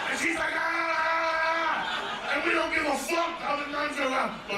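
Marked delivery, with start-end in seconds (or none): 0.6-2.3 short pained cry from the nun; a quick ouch from the nun; mock yelp of pain. Nun crying out as her arm is twisted, yelping.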